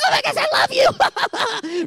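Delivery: squealing high gushing fan shout. Pretend. Fan gushing over a YouTuber, excited high squeal. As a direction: gush like a starstruck fan online. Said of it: "you were like".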